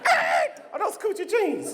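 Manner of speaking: woman in a car calling out, high and drawn out; impressed woman calling out. Acted. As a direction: shout it out of a car window, thrilled.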